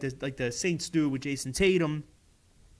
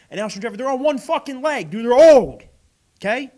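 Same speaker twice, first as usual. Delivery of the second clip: much louder and higher, building to the end; heated rant; 7 semitones higher, 11 dB louder than usual. Real, not acted. Fan ranting at the Eagles over their draft picks, heated and loud. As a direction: rail at the team, getting hotter each line.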